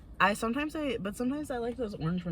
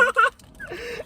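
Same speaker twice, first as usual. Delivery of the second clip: loud shrieking laugh; high burst of laughter; 9 dB louder than usual. Real, not acted. Laughing at spider story.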